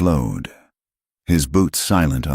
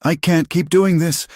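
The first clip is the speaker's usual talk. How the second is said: high, louder, near tears; choked out; 13 semitones higher, 3 dB louder than usual. Acted. Terrified Nora choking out words, near tears. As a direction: force it out through panic. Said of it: "she choked out".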